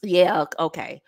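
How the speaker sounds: low, quiet, resigned okay; agreeable man. Acted. Good guy accepts her celibacy, low and quiet. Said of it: "he's like".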